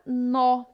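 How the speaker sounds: "nó" drawn out with an open O; open vowel in "nó". Shown as a demonstration. Teacher introducing the example word, long open O in "nó". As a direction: stretch "nó" on a wide open O. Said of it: "Como é um som aberto".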